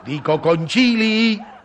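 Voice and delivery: concili said slowly, spelled out with a laugh; slow it down for the deaf son; father repeating the question slowly, with a laugh; slow insistent repeat. Acted.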